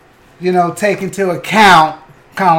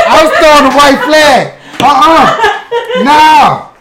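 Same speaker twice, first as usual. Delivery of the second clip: louder and higher protest; 7 semitones higher, 6 dB louder than usual. Real, not acted. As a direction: insist loudly. Protesting loudly.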